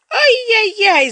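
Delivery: high, loud, drawn-out yelp of pain; acted howl of pain; sudden yell. A narrator's reading. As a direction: cry out as if suddenly bitten. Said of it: "заорала вдруг басом царица".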